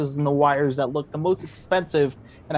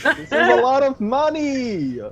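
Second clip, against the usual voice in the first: slow, higher ghostly voice; 7 semitones higher, slower than usual. Acted. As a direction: moan it out like a ghost. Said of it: "I sound like a ghost".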